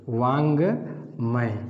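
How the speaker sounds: वांगमय said very slowly, each syllable drawn out; slow spelled-out word. Shown as a demonstration. Hindi teacher slowly sounding out वांगमय for students. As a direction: stretch out वांगमय syllable by syllable for the class. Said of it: "जैसे एक शब्द है वांगमय".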